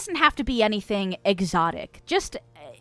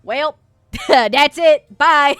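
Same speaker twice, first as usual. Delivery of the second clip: mock sign-off; 5 semitones higher, 11 dB louder, much slower than usual. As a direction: fake abrupt goodbye, laughing. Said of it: "I'm kidding, I'm kidding".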